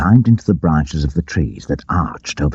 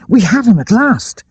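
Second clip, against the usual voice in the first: high, eager triumphant call; Much crying out that they have him at last; excited cry; 4 dB louder than usual. A narrator's reading. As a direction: crow with the news. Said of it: "cried Much".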